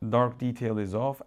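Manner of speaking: usual speaking voice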